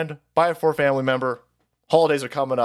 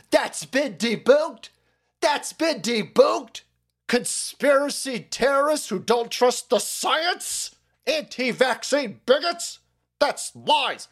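slow, higher, sneering put-on voice; mock fact-checker; 7 semitones higher, slower than usual. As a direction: drag it out like a smug fact-checker. Mocking the debunkers.

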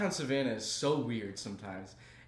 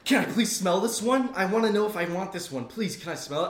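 put-on eager teen; 6 semitones higher, 6 dB louder than usual. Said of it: "And she's like".

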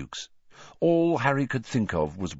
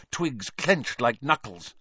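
ordinary delivery

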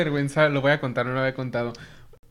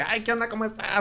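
cheery greeting; 6 semitones higher, 3 dB quieter than usual. Retold or imitated. Latin warm greeting.

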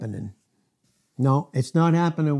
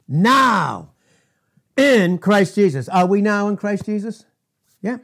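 loud, driving preacher cadence; forceful preaching; 7 semitones higher, 8 dB louder than usual. Real, not acted. Preacher driving the point home to his congregation, loud and forceful.

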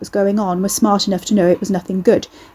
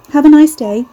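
bright cashier voice; 7 semitones higher, 8 dB louder than usual. A narrator's reading. Cashier forcing polite cheer.

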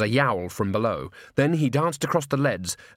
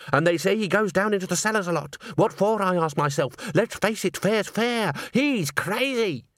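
higher-pitched accuser, climbing as it goes; suspicious gossip; 8 semitones higher than usual. A narrator's reading. Chorus member arguing against Walter, accusing. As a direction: build the case to a high verdict.

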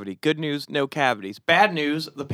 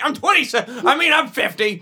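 higher nervous correction; high flustered voice; 10 semitones higher, 4 dB louder than usual. Retold or imitated. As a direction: squeak out the lie. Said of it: "I'm like".

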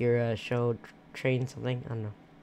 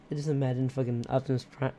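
usual speaking voice

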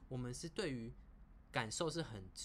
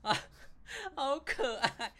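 high, loud, drawn-out laughing delight; amused, cooing; 14 semitones higher, 8 dB louder, slower than usual. Real, not acted. Listener tickled by the grey rabbit story, laughing delight. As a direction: coo it through a laugh, high and slow.

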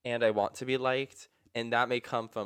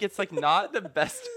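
ordinary talk, nothing marked

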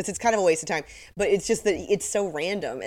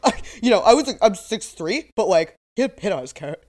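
mocking bluster; 3 semitones higher, 7 dB louder than usual. Mocking the witness.

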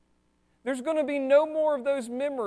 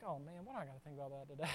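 muttered aside; 8 semitones lower, 17 dB quieter, much faster than usual. Retold or imitated. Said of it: "you're like".

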